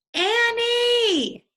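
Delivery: very high, loud worried shout; shouting her name. Acted. Jack yells for Annie while racing up the hill, very high shout. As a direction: yell her name as you run up the hill.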